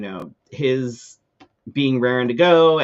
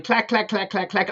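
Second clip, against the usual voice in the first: quick, higher-pitched clack-clack-clack; imitating clacking heels; 7 semitones higher than usual. Woman decides to walk in behind me, imitated clacking heels. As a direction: mimic heels clacking along the row.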